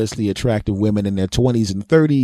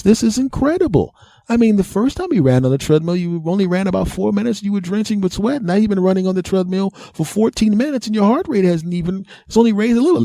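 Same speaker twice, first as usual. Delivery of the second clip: much higher, astonished doctor; amazed doctor; 10 semitones higher than usual. Retold or imitated. Doctor amazed at Mister Thomas's stamina, high and astonished. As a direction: marvel at the patient's stamina, pitched up.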